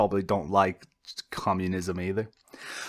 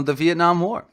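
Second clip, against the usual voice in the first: Vietnam War called out higher and louder, rising; eureka; 8 semitones higher, 6 dB louder than usual. Real, not acted. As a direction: blurt the example as it comes to you. Hits on example after pause.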